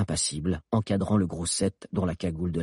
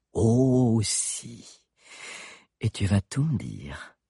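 menacing witch; 3 semitones higher, much slower than usual. Acted. Witch threatening.

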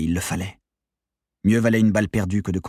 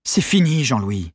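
raised, firm appeal; insistent; 4 semitones higher, 3 dB louder, slower than usual. A narrator's reading. Accomplice urging leader to surrender, insistent. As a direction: press him to give up. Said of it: "insista Maxime Prieur".

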